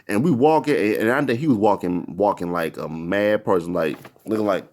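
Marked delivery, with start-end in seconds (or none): none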